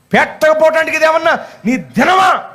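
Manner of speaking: high-pitched angry retort, re-enacted; angry inner outburst. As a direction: vent the unspoken fury in a high voice. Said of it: "కడుపు మండిపోయి ఉన్నానండి నేను కోపంతో". (లోపలి కోపాన్ని హెచ్చు గొంతుతో నటిస్తూ)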